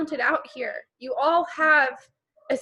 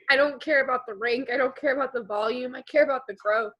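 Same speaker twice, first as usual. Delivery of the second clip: ordinary delivery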